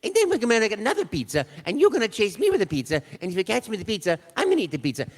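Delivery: playful Italian chef voice, teasing a chase game; Mario voice. Acted. Actor improvising pizza games as Mario, high bubbly voice. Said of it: "I enjoyed the voice".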